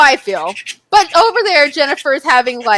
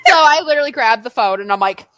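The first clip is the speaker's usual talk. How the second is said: ordinary delivery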